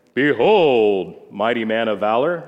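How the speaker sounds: deep, loud and grand; booming angel voice. Retold or imitated. Angel of the Lord booming to Gideon, grand and loud. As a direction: announce it like a towering angel.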